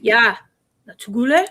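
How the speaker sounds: punchy YEAH, then "tsugule" rising like a challenge; Korean attitude. Shown as a demonstration. Teaching Kat a phrase to use on her husband, with attitude. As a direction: demonstrate it with sassy attitude. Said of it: "You gotta say it with attitude".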